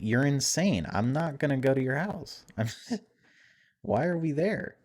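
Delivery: ordinary talk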